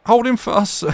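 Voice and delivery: plain reading voice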